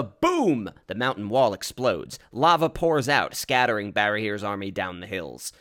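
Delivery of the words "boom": loud, high burst; explosive narration. A narrator's reading. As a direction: hit it like a sudden blast.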